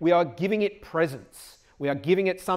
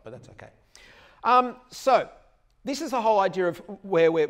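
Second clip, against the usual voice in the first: ordinary talk, nothing marked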